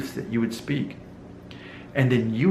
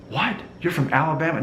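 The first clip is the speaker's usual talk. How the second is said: mock viewer disbelief; 7 semitones higher than usual. Imagined viewer reacts in disbelief.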